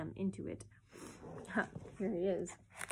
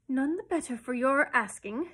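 tossing her head; 4 semitones higher, 7 dB louder than usual. A narrator's reading. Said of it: "tossing her head".

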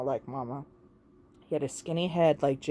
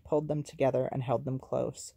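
ordinary delivery

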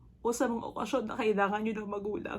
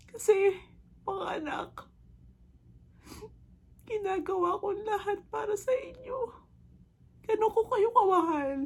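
high, emotional appeal; tearful pleading; 8 semitones higher than usual. Acted. Mother tells her children how much she loves them, tearful pleading. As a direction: plead with your children, voice pitched high with tears.

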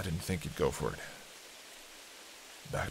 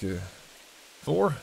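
usual speaking voice

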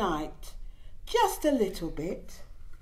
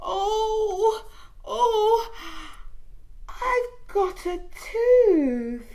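put-on excited baby voice; 11 semitones higher, 4 dB louder, much slower than usual. Baby discovers tooth.

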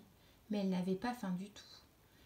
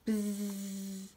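buzzing bee sound. A narrator's reading. Narrator buzzes as the bumblebee lands beside the caterpillar. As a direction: buzz it out like a bee landing.

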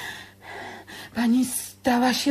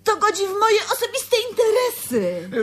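tearful plea; 8 semitones higher than usual. Acted. Refusing, near tears.

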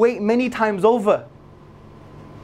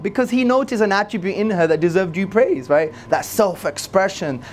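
ordinary delivery

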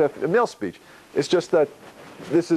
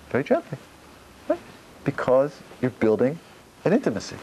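ordinary talk, nothing marked

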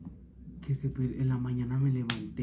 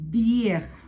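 playful exclaim; 8 semitones higher, 7 dB louder than usual. Acted. Teasing exclaim at old clothes.

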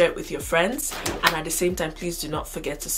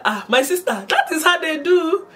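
higher, emphatic mock exasperation; acted exasperated complaint; 11 semitones higher, 5 dB louder than usual. Airport man explaining to the newcomer, acted loud exasperation. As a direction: complain to her like a worked-up stranger. Man answering her with indignation.

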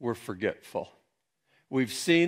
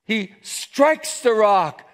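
loud, high and drawn out; full-force preaching; 10 semitones higher, 9 dB louder, slower than usual. Acted. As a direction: hammer out the narration slowly.

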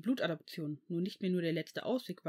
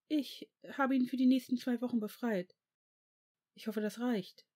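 higher-pitched, shocked witch; understated shock, pitched up; visibly shocked; 5 semitones higher than usual. A narrator's reading. School nurse signs the excuse for Severus, shocked. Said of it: "sagte sie sichtlich geschockt".

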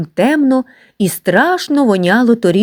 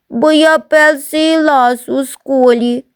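put-on tearful child; 7 semitones higher, slower than usual. Crying boy explains.

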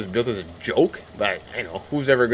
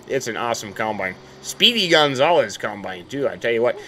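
ordinary talk, nothing marked

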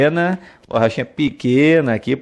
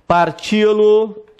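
parti-lo drawn out and higher; slow, high emphasis; 8 semitones higher, much slower than usual. Shown as a demonstration. Teacher showing the class where the stress falls, slow and high. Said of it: "tá aqui".

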